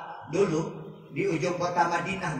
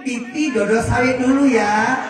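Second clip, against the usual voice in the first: cutesy husband; 6 semitones higher, 7 dB louder, slower than usual. Acted. Husband's pet-name talk to wife.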